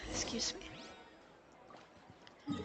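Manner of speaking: ordinary talk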